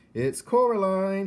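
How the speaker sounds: slow, high, loud correction; calling back. Acted. Coraline calling up to correct the old man, loud. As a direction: call it back up, spelling it out.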